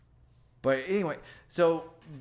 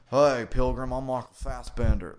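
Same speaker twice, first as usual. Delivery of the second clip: a Texan howdy, done broad and louder; robot's fake Texas accent; 6 dB louder than usual. Other robot greets in a mock Texas accent. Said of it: "exactly like what an alien robot would think people in Texas sounded like".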